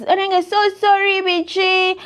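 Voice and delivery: high, loud pleading mock sorry; whiny mock apology. Imitating the client apologizing to her, high whiny pleading. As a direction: beg like the sheepish client.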